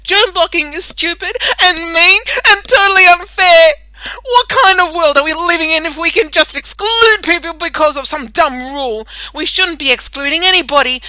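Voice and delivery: high, loud, crying protest; angry outburst. Acted. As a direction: rant it out, near tears with fury. Melanie's angry protest speech.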